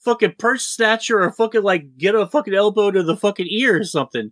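high, laughing hammed-up bravado; mock tough-guy Light. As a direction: ham up a cocky punk sure he can win. Voicing Light plotting to attack.

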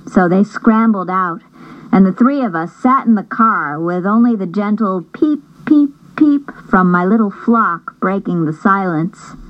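ordinary delivery

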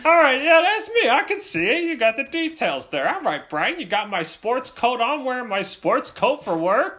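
high-pitched dad imitation, loud then easing; placating dad. Dad acknowledging son's drawing, high mock placating. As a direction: run through it as a hammed-up dad.

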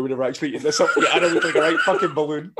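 high, laughing. Real, not acted. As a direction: crack up. Imagined scene, laughing.

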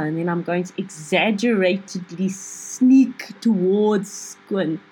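normal talking voice

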